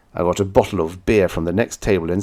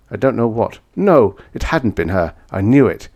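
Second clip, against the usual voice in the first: ordinary delivery, nothing marked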